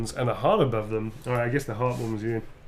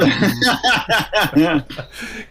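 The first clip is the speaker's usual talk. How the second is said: loud, high burst of laughter; 7 semitones higher, 5 dB louder than usual. Real, not acted. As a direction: crack up in a long run of laughs. Cracks up after joke.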